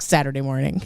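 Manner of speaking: ordinary talk, nothing marked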